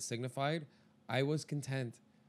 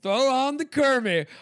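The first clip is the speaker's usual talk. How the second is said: much louder and higher, slow; excited call; 11 semitones higher, 12 dB louder, slower than usual. Retold or imitated. Picturing the first snow, calling for the album loud and high. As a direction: call it out like a cheer. Acting excited self in winter.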